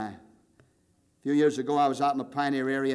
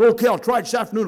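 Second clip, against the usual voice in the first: determined challenge; 8 semitones higher, 9 dB louder, faster than usual. Retold or imitated. Pastor's wife taking the challenge.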